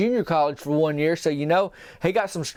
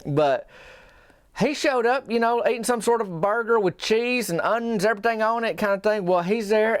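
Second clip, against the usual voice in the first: ordinary delivery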